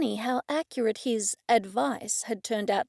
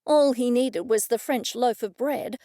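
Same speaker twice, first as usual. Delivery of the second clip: plain reading voice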